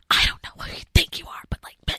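fully whispered mock argument; imitated whispered yelling. Imitating ASMR edit of women yelling, whispered. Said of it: "but they're whispering".

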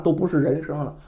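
ordinary delivery

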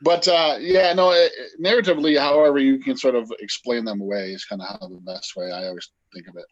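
ordinary talk, nothing marked

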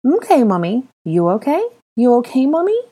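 put-on high little-boy voice with a pout; small child asking, pout in the voice. Boy asking Hermione if she's okay, pouting. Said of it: "the boy asked with a pathetic pout".

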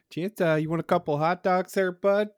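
a jolly Midwest uncle offering hot dogs; Midwest accent. Retold or imitated. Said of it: "that Midwest accent".

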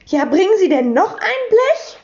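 high-pitched, alarmed question; startled. A narrator's reading. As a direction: ask it taken aback, voice jumping up. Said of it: "fragte Frau Rotkohl erschrocken".

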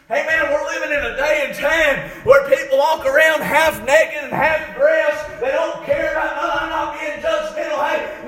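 full-voiced, driving sermon delivery; impassioned preaching. Real, not acted. Preacher urging the church to be ashamed of sin, impassioned preaching. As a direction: preach it hard to the congregation.

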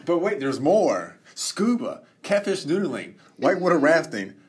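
loud, high TV pitchman; infomercial. Pretend. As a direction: sell it like a late-night ad. Infomercial pitch "but wait.

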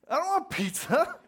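put-on indignant teen yell, want stressed; exasperated outburst. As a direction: blurt it out like a hurt teenager. Teen upset at dad's offer.

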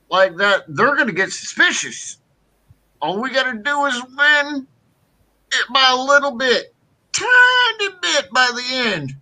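mock Biden voice pitched high; Joe Biden impression. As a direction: pitch it up like an old president pleading. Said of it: "Just use your Joe Biden voice".

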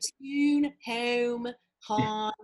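amateur Pittsburgh-Maryland accent, vowels stretched out. Shown as a demonstration. Showing a co-host how Pittsburgh talks, drawn-out accent demo. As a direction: demonstrate Pittsburgh vowels word by word. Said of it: "I suck at it, but, like, yeah, it's Pittsburgh and Maryland".

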